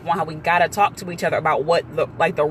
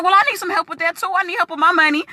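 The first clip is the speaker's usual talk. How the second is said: eager request; 12 semitones higher, faster than usual. Retold or imitated. Woman eager for money help.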